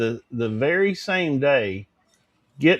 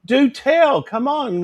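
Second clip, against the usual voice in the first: high, drawn-out mock shock; mock scandalized; 7 semitones higher, 3 dB louder, slower than usual. Host teasing a viewer for gossip about Dolly, mock scandalized. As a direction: play the nosy gossip, pitched well up.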